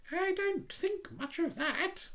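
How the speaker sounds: prim little duck voice, high. Acted.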